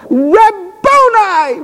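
high, slow drawn-out cry of "Rabboni"; cried out. Acted. Mary recognizing her teacher, crying out high and slow. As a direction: stretch it out high in joyful recognition. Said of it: "She cried out in Aramaic".

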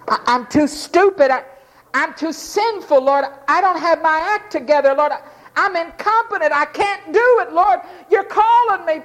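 rising to a high, pleading, unworthy cry; humble plea. Retold or imitated. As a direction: beg the Lord to choose someone else. Voicing a humble called man.

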